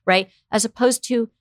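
ordinary talk

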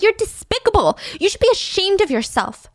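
mock outraged scolding; scolding bakery worker. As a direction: cuss out a neglectful mother. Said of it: "She's like".

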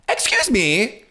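high, sharp excuse me; imitating her own affronted snap; indignant. To the guy insulting [name], a high indignant retort. Retold snapping at rude guy.

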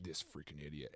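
low, soft muttering; imitated drunken mumble; mumbled cursing. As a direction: grumble it under your breath. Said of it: "he's mumbling stuff".

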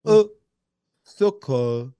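"au secours" in a French accent, split in two. Shown as a demonstration. Host teaching cohost to say "au secours", French accent. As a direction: sound out French "au secours" slowly for a learner. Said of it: "If you wanna do a French accent".

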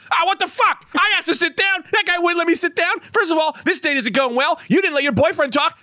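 high-pitched outraged rant; going crazy. Retold or imitated. Man jumps from behind a door at gossiping strangers, going crazy. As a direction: rant way up high as if you have snapped. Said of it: "I just go going crazy".

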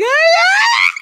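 loud, high-pitched scream; tantrum scream. Retold or imitated. Woman re-enacting her teenage door-slamming fit, loud scream. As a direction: let out a piercing teen tantrum shriek. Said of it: "running my room screaming".

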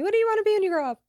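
high, fast, sing-song grown-up question; lightly voiced adult asking a little girl. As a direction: mimic a chirpy adult quizzing a kid. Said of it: "people would ask me".

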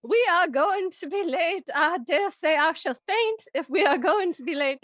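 high-pitched, theatrical Southern Belle. Shown as a demonstration. Parent fretting to kids about being late, hammed-up Southern Belle. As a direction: lament like a swooning Southern Belle. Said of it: "like, Southern Belle voice".

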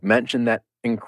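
ordinary talk, nothing marked

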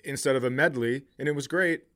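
normal talking voice

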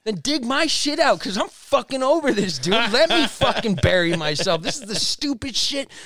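high, loud fed-up yelling; loud exasperated outburst at a friend; retold angry yelling. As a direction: snap back at him, done with it all. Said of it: "I started yelling at him".

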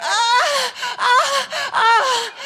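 high, loud hammed-up cries of pleasure; mock orgasm cries. To the audience, a loud mock climax before her story. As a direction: cry out high and loud, fully hamming it.

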